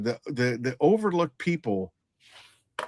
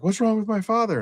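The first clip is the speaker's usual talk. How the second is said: much higher voice, mildly panicked; imitated alarmed question; 10 semitones higher than usual. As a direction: mimic a worried daughter demanding news. Arriving daughter's alarmed question, retold.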